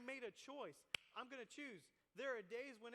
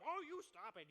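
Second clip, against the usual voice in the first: higher, louder fussy scold; scolding teacher; 4 semitones higher, 4 dB louder than usual. Retold or imitated. Preacher voicing a teacher breaking up a fight, a fussy scold. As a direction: fuss at the kids like a teacher. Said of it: "This teacher comes up".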